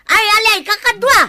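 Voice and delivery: loud call to friends across the field. Acted. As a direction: shout it out to the companions. Calling out to companions. (umaw a napigsa)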